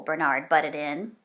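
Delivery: plain reading voice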